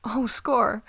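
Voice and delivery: loud, delighted outburst; excited exclamation. Acted. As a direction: cheer at a lucky find. Finds food in her bag.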